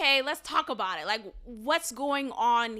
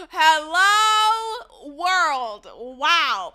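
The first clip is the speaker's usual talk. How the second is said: mock amazement; 3 semitones higher, 8 dB louder, much slower than usual. Podcaster reacting to Miranda's affair, mock amazed. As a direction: stretch it way out, loud and pitched up.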